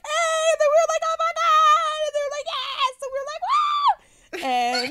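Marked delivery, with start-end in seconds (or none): 0.0-3.6 high-pitched back-and-forth fan squeals; retold screaming. Fans and group screaming at each other onstage, mock screams. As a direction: imitate an excited screaming exchange, very high. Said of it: "we screamed and then they screamed".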